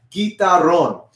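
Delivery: guitarrón as "gee-tar-RON"; Spanish pronunciation. Shown as a demonstration. Teacher has everybody repeat, Spanish pronunciation. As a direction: model "guitarrón" in Spanish, final syllable stressed. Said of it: "Everybody say that".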